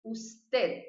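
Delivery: "usted" with the final D barely sounded; soft final D. Shown as a demonstration. Teacher demonstrating the final D. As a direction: end "usted" lightly on the D. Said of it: "Eu vou falar assim".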